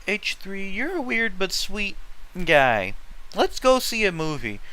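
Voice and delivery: lightly voiced, cheerful girl; happy Taylor. A narrator's reading. Reading Taylor's happy invitation to the mall, lightly voiced. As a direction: read her line with a light happy lift. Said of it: "said Taylor, looking happy".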